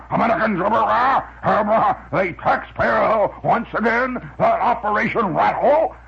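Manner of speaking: blustering Major Hoople newscaster, slow and grand; pompous newscaster. Retold or imitated. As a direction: boom it out like a windbag anchor. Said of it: "newscasters that are Major Hoople".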